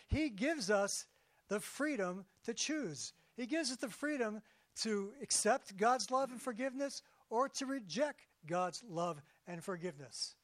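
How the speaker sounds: ordinary talk, nothing marked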